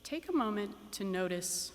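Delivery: calm, unhurried meditation guide with long pauses; guided meditation. Acted. Teacher guiding graduates into meditation, calm and measured. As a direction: settle the room gently, one instruction at a time.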